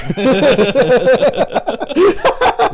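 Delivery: high, loud burst of laughter. Real, not acted. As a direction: crack up loudly. Cracking up at joke.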